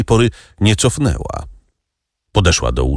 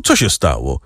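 plain reading voice

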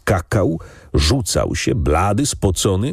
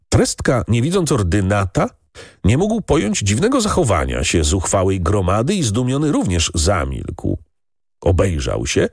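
ordinary delivery

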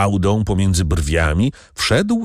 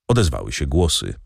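usual speaking voice

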